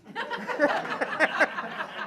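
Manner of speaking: long, high burst of laughter; amused laugh. Real, not acted. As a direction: laugh out at your own punchline. Laughs at her own punchline.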